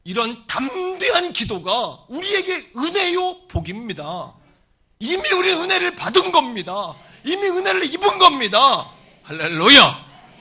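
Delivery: high, very loud proclamation; full-force preaching. Real, not acted. Preacher declaring grace to the congregation, full-force preaching. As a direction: drive it home to a shouted hallelujah.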